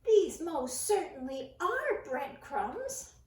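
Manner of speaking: loud, slow insisting; firm emphatic mouse. A narrator's reading. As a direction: hammer each word. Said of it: "He said emphatically".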